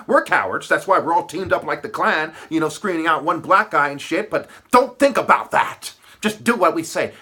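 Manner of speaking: loud sarcastic imitation; mocking the right. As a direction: voice the cowards with contempt.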